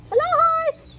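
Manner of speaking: very high squeaky duck greeting; duck character. A narrator's reading. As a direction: call out in a tiny, high-pitched duck voice. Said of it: "said Duck".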